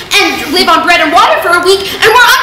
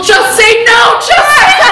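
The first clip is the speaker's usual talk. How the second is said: mock mom shout; 5 semitones higher, slower than usual. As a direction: bark the slogan like a mom. Mother shouting slogan.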